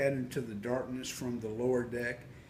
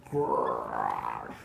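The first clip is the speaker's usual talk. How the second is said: deep low growl; 13 semitones lower, 4 dB louder than usual. A narrator's reading. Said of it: "started to growl again".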